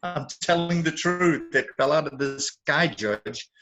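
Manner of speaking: mock courtroom plea. Defendant insisting the story is true, mock-pleading. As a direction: insist to the judge, hamming it up a little.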